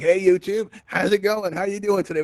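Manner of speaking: lightly hammed-up shout-out; calling out greeting. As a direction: wave out an upbeat greeting to the camera. Mock greeting out to YouTube viewers.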